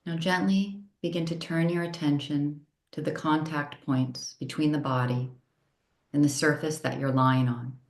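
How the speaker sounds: unhurried yoga nidra guidance, long pauses between phrases; calm, measured meditation voice, each phrase settling downward; guided meditation. Real, not acted.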